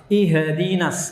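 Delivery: slow "Ihdinas" as "ihe-dinas", extra vowel after H; wrong-way "Ihdinas" with a schwa after H; bounced H. Shown as a demonstration. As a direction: bounce the H into a little "he". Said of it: "Sebagian orang memantulkan hak sukun".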